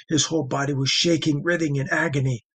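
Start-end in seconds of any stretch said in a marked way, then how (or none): none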